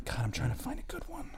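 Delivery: hushed, breath-only grumble; whispered aside. Real, not acted. Dater swiping for a good profile, whispering. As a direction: whisper it to yourself under pressure.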